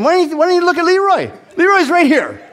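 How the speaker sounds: high, fast, pleading thought; panicky inner plea. Retold or imitated. Thinking at the stranger, high fast panicky plea. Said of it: "that's how intimidated I was of people".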